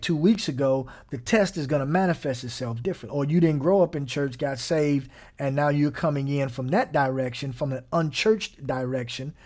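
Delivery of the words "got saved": ordinary talk, nothing marked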